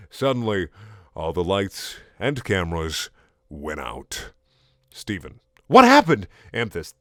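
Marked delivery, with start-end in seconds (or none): 5.7-6.3 high, loud startled question; hammed-up shock. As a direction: blurt it out in alarm, pitched way up. Voicing Steven startled by blackout.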